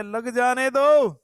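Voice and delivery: high, loud, long-drawn acted reply; loud dismissal. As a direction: wave it away, stretching the words upward. King dismissing news of palace fire.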